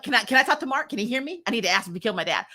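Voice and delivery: ordinary delivery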